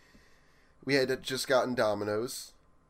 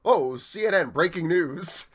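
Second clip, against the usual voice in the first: slow, louder mock newscaster announcement, pitched up; hammed-up breaking news voice; announcer; 7 semitones higher, 6 dB louder, slower than usual. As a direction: boom it out like a TV news anchor. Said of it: "and I just go".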